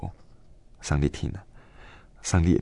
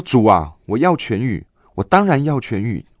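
higher, louder cry to the Lord; inner cry; 5 semitones higher, 5 dB louder than usual. Retold or imitated. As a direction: cry out to be healed. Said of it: "我心中就大喊". (心中大喊要痊愈)

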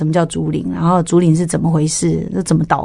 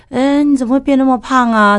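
mock busybody remark; 7 semitones higher than usual. Imitating people judging her weight.

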